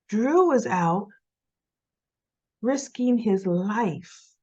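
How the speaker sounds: slow, pointed, dragged-out pauses; dramatized slow reveal. Retold or imitated. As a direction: lay each phrase down slowly to rub it in. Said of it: "he didn't really say it like that".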